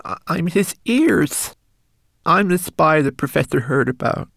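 high, tearful, breaking voice; sobbing confession. Acted. Homunculus confessing his spying, sobbing. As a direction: pour out the guilt through tears. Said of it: "sobbed the homunculus".

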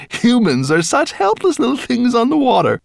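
high, loud villain voice; gloating dragon. A narrator's reading. Dragon eyeing humans in their boat, gloating. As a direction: sneer at helpless prey with relish.

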